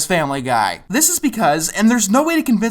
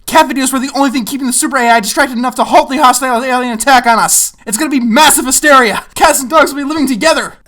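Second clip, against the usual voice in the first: higher, louder hammed-up hysteria building to a shout; mock panic; 4 semitones higher, 5 dB louder than usual. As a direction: rant like the world is ending. Mock apocalyptic panic, ending in shout.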